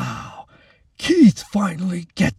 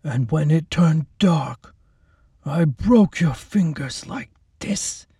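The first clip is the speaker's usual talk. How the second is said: slow, breathy threatening whisper; menacing whisper; 4 semitones higher, slower than usual. Acted. Orderly whispering to the girl how he hurt her, menacing. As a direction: whisper the threat slowly, savouring it.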